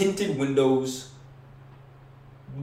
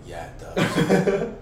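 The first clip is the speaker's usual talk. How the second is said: laughing; 8 semitones higher, 5 dB louder, much slower than usual. Retold or imitated. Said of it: "He's like".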